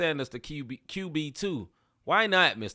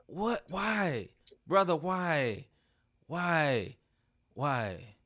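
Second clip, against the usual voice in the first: softer, falling, fading plea; fading exasperated why; 3 semitones lower, 6 dB quieter, much slower than usual. Real, not acted. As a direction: trail off in disbelief. Pleading at dog owners.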